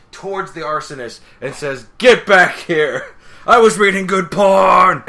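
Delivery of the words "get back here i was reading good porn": hammed-up, high, drawn-out roar; outraged comic yelling; furious shout. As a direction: bellow it after someone running away. Acted furious chase after arsonist.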